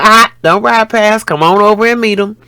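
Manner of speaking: loud, high shout across the yard; calling out. Retold or imitated. Mother calling her son over to meet him, loud high shout. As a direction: hail the passing car.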